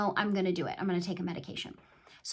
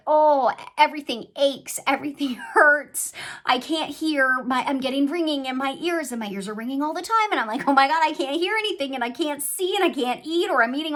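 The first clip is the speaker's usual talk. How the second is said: higher and louder, a hammed-up moan; mock whiny complaint; 6 semitones higher, 8 dB louder than usual. Retelling the side effects to viewers, a mock whine. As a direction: play up the misery, half joking.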